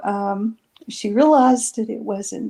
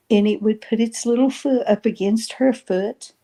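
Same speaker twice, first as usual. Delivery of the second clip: ordinary delivery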